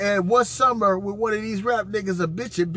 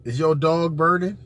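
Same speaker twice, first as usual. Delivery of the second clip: ordinary talk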